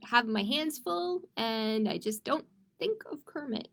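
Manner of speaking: normal talking voice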